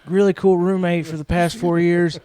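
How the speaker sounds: high, loud mock-sentimental farewell; Biden as roommate. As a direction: say a hammed-up goodbye to your roommate. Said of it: "I like to imagine that Biden".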